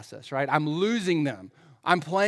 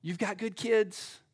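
soft, higher, slow reassurance; comforting; 4 semitones higher, 5 dB quieter than usual. Acted. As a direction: console gently, like an arm round the shoulder. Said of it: "put their arm around me and say".

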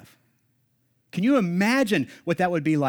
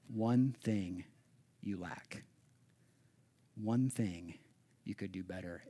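much softer, hushed and tender; gentle correction; 8 semitones lower, 12 dB quieter, slower than usual. Retold or imitated. Pastor voicing Jesus softly correcting the listener, hushed and tender. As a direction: say it gently, barely above a breath. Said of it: "gently, specifically correcting".

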